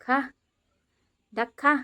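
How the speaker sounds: put-on high, bright play voice for a small child; playful toddler-talk. Mother playing house with her toddler, high coaxing voice. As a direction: coax the little one along in pretend play. Feeding toy duck with toddler.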